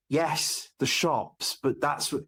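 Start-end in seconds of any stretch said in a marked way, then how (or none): none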